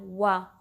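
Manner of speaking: वा with rounded lips, not using the teeth. Shown as a demonstration. Teacher to students, showing waw with rounded lips. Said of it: "आपने होंठों को गोल करना है".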